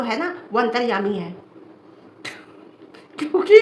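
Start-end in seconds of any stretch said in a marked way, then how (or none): 2.2-3.6 laughing. Real, not acted. Laughing mid-rant at troll.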